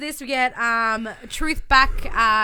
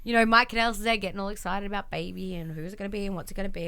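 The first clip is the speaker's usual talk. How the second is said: usual speaking voice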